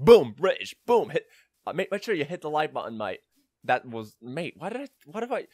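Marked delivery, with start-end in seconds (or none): 0.0-3.2 punchy mock British YouTuber, drifting Australian; British slipping into Australian. Mocking YouTubers' sign-offs, British accent turning Australian. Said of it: "end up just joining into an Australian accent". 4.2-5.5 higher, put-on Australian voice; accidental Australian accent. Catching a failed British impression, lapsing into Australian. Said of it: "joining into an Australian accent by default".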